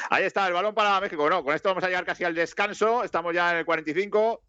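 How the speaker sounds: mock sports commentator over the live match; play-by-play commentary. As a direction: call the game like a TV commentator.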